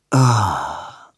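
a short breathed broken sigh; broken breathy ah. Acted. Edwin lets out a broken, breathy ah. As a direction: let a broken breath escape. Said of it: "hauchte Edwin, ein gebrochener Laut".

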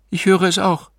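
high-pitched, eager woman's voice; excited séance client. A narrator's reading.

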